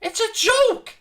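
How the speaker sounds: incredulous high yelp, it's a joke; outraged cry. Real, not acted. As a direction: pitch jumps way up in disbelief. Outraged at referee decision.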